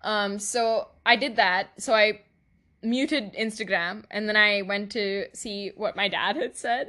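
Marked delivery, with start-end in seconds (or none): none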